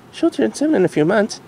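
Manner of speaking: Future Derpy voice. Acted. Future Derpy telling the Doctor, in a high mare voice. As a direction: voice grown-up Derpy gently.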